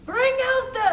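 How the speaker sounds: mock call, high and loud. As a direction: belt it out like a summons. Acted call in retold film scene.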